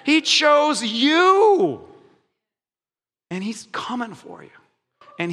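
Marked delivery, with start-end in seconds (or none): 0.0-1.8 high, slowed emphatic preaching. Real, not acted. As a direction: drive it home slowly and high. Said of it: "emphatic preaching climax".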